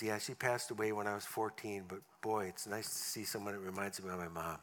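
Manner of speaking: the biker speaking fondly of his late mother; tender. Retold or imitated. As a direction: let it go soft and sincere. Tender retold line.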